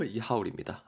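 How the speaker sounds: usual speaking voice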